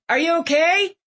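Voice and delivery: high, rising call; mild acted shout, lifting at the end; shouted question. A narrator's reading. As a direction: call up to check on her. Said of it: "Sam shouted".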